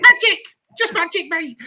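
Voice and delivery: very high, loud, squealing fan voice; giddy fan impression. Host retells the giddy fan after Salford, squealing high. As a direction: gush it like a giddy supporter. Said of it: "How did it go, Nick?".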